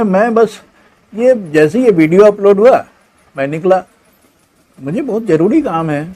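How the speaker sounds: a selfish son squirming out of it; dismayed son. Acted. Son hurrying.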